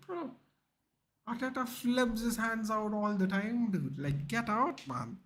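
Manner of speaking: high slow exasperated retort. Retold or imitated. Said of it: "I was like".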